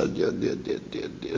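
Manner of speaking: breathless whispered oddio; whispering. Acted. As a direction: whisper it in bliss. Whispered bliss.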